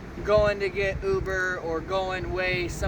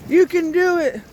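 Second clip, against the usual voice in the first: cheering; 10 semitones higher, 8 dB louder than usual. Real, not acted. Cheering on during lifting.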